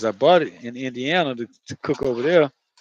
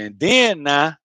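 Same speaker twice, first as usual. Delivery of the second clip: loud Florida twang on "then now"; Florida accent; 3 semitones higher, 6 dB louder, slower than usual. Shown as a demonstration. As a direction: show off how Florida says it. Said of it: "that's how we say it in Florida".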